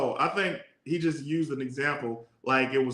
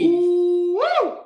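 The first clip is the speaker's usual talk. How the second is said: shocked; 13 semitones higher, 5 dB louder than usual. Real, not acted. Reacting to shocking story.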